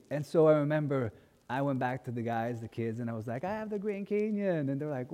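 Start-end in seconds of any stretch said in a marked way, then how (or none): 3.4-4.7 put-on proud kid boast.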